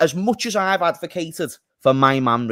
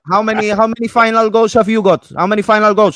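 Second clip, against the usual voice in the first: loud mock Italian accent; 4 semitones higher, 6 dB louder than usual. Player taunting a fan about final goals, mock Italian accent. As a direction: heckle in a put-on Italian accent. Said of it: "that doesn't sound Italian".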